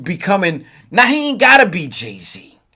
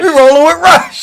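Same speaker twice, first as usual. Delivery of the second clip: loud, high-pitched crew chant; raised-voice imitation of a rallying call; hyped catchphrase; 9 semitones higher, 7 dB louder than usual. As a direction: call it out like a hype man. Calling out a remembered crew catchphrase.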